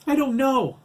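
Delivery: very high, loud panicked cry; frantic, high-pitched wail of an answer; hysterical reply. A narrator's reading. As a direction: shout it back in hysteria. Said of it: "Matt hysterically replied".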